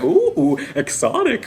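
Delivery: playful mock awe; mock impressed. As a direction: tease it as exotic. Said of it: "I was just like".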